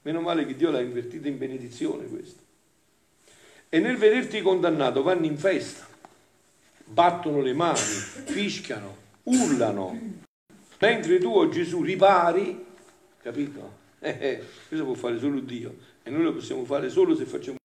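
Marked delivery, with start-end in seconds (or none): 3.7-12.7 raised, louder reading to the congregation; impassioned devotional reading. Acted. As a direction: declaim the sacred text with force. Reads devotional text aloud.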